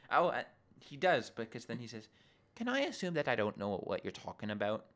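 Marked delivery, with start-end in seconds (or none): 2.5-4.8 muddled, almost Irish lilt on a retold line; accidental Irish-ish accent. Quoting Darren's question, accidental Irish-ish accent. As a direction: slip into a vaguely Irish accent. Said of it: "what accent was that talking?".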